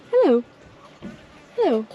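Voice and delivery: ordinary delivery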